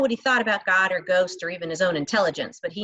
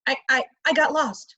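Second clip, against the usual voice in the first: nervous stammer; 6 semitones higher than usual. A narrator's reading. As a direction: stumble over the first words. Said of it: "not stammering any more than".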